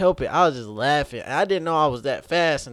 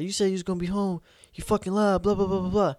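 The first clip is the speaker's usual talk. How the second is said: higher mock rant at the girlfriend; angry boyfriend; 8 semitones higher, faster than usual. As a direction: rant at her, a bit higher and heated.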